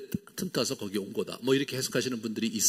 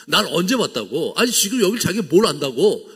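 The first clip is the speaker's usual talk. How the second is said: indignant scoff; 4 semitones higher, 6 dB louder than usual. Retold or imitated. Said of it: "막 이렇게 생각하신 것 같아요".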